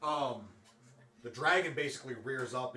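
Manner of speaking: ordinary talk, nothing marked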